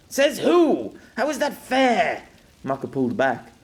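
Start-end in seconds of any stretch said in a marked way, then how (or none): 0.1-2.2 loud, high outburst; indignant protest. Acted. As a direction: protest the unfairness at full voice. Man protests the parting.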